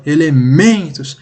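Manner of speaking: slow ELEMENTOS, MEN drawn out and punched; stress on MEN. Shown as a demonstration. Teacher asks for attention to the tonic syllable, stressing MEN in ELEMENTOS. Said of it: "a sílaba que você fala mais forte".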